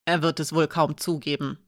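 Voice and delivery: understated tightly held anger; a touch of strain in the voice. A narrator's reading. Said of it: "sagte sie gepresst".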